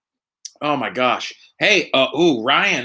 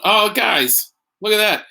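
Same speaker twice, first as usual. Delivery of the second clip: ordinary talk, nothing marked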